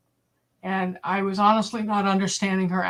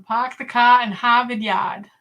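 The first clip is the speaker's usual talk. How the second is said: classic r-less Boston accent demo; 2 semitones higher, 4 dB louder than usual. Shown as a demonstration. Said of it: "I had a Boston accent".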